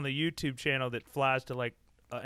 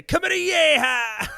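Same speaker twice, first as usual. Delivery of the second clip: high, loud, slow whooping cry; shouted catchphrase; 12 semitones higher, 9 dB louder, slower than usual. Acted. Pitching a catchphrase to his co-host, high whooping yell. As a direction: belt it out like a rally yell.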